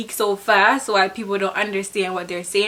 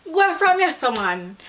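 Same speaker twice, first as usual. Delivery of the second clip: high, dismissive patois; mild shoo-away; 6 semitones higher than usual. Shown as a demonstration. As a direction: wave someone off, up high. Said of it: "my next word is".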